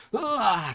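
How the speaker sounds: high exclaimed "ah"; sudden high cry; crowd's scream. A narrator's reading. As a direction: let out a high narrated scream.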